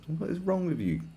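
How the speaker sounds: ordinary talk, nothing marked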